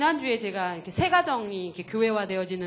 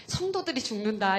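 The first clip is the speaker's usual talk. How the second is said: pushy retold urging; 6 semitones higher than usual. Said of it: "이러면서 제가 막 푸시를 했거든요".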